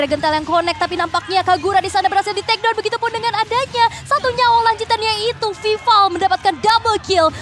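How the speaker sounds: loud, high play-by-play call; excited commentary. Real, not acted. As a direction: hype up the team fight. Play-by-play of a team fight.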